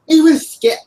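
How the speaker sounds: high, loud attempt at a British accent; bad British accent. Shown as a demonstration. Podcaster showing a co-host the impression, mock British yell. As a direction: shout it in a shaky-at-best British accent. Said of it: "I can't do a British accent".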